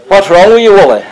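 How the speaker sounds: retold loud question. Acted question to suffering workmate.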